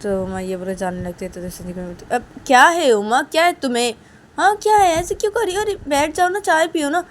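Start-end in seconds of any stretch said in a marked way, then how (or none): 2.1-7.0 high, loud insistent coaxing; acted mother, voice raised in protest; exasperated urging. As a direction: press her to sit back down. Mother urges Uma to stay.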